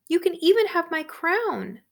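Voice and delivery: high and loud offering; eager princess. Acted.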